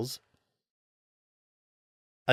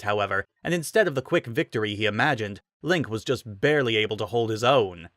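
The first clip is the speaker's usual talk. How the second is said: ordinary delivery, nothing marked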